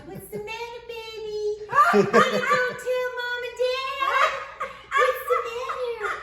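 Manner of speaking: high, loud sing-song taunt; big sister taunting the little one, high and sing-song; mocking big sister. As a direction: tease a little kid from above. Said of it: "she'd go".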